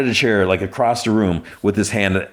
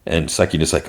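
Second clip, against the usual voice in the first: usual speaking voice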